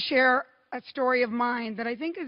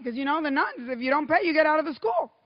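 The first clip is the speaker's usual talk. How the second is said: normal talking voice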